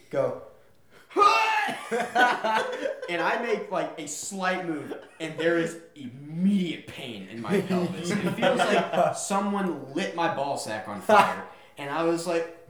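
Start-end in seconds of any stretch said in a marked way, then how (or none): 1.1-3.0 high, loud laugh bursting out; burst of laughter. Real, not acted. Laughing at his own story. 3.1-11.4 words broken by laughter; laughing while telling. Real, not acted. Laughing through the painful memory.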